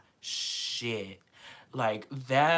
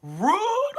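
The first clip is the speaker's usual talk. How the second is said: very high, loud shriek of rude; screamed rude; 7 dB louder than usual. Retold or imitated. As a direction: shriek it at the stage. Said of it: "I was also screaming like".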